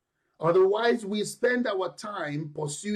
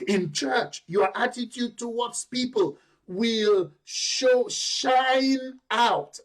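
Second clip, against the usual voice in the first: higher, louder preaching, driving each point home; preaching at full force; 4 semitones higher, 3 dB louder than usual. Acted. Preacher urging the church to show their light, full-force preaching. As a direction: preach it out to the congregation.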